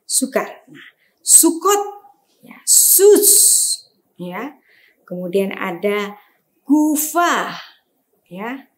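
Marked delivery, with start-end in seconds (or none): 1.2-3.3 sukot and sus sounded out high; over enunciating. Shown as a demonstration. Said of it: "teacher models Hebrew words". 6.6-7.5 gufa sounded out high and clear; over enunciating. Shown as a demonstration. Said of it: "teacher models Hebrew word".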